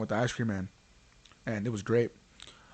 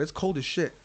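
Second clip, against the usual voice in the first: ordinary talk, nothing marked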